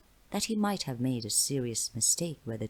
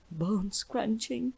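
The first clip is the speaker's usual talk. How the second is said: grim hush; 8 semitones higher, much slower than usual. A narrator's reading. Girl adds grim detail.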